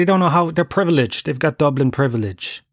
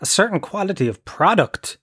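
quoting Tubridy; 7 semitones higher than usual. Retold or imitated. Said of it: "that Ryan Tubridy thing".

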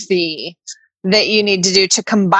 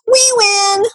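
higher, gloating crow at the start; mock gloating company; 5 semitones higher than usual. Imagining the company gloating over her money, in a higher gloating crow. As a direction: crow like a smug winner. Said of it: "you're gonna be like".

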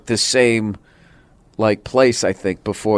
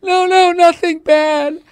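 high, slow mock wail of a tearful wife; hammed-up sobbing, drawn out and loud; crying hysterically; 5 dB louder, slower than usual. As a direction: imitate a wife blubbering over a movie. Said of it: "she was crying hysterically".

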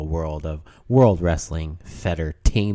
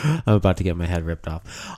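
ordinary delivery

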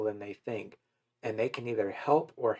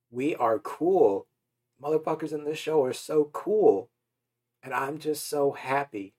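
excited; 4 semitones higher, 4 dB louder than usual. Real, not acted. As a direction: celebrate every word. Joyful celebrating, we look good.

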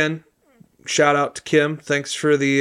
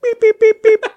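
high, loud robot beeping; Nerdbot robot beeps; 10 dB louder than usual. Pretend. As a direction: beep like a tiny robot. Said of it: "Nerdbot, do you have anything to say?".

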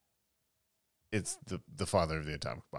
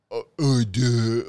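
loud, dopey grunting; dumb dog voice; 4 semitones higher, 10 dB louder, slower than usual. Retold or imitated. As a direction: play him as a dim-witted dog. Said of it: "it's like your dog voice".

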